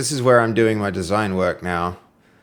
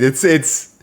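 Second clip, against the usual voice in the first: ordinary talk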